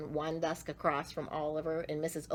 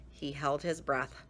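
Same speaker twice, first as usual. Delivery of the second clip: ordinary delivery, nothing marked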